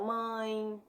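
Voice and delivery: "mãe" com ãe nasal prolongado; som nasal. Shown as a demonstration. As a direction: deixe "mãe" ressoar no nariz. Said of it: "esse é o nasal". ("mãe" demonstrado com nasalidade alongada)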